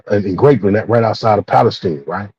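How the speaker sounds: usual speaking voice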